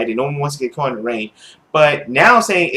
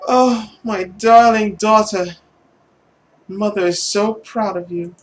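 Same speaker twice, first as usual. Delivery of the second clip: proud mother; 6 semitones higher than usual. Acted. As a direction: savor each word like a doting mother. Voices the mother approving.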